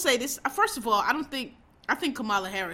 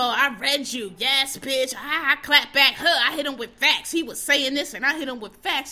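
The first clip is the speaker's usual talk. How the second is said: higher, louder smug braggart mockery; mocking smug tweeter; 4 semitones higher, 6 dB louder than usual. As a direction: lampoon a self-satisfied online arguer. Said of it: "but you wanna make a say of it. You know".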